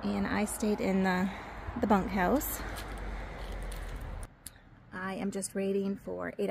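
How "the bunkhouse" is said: ordinary talk, nothing marked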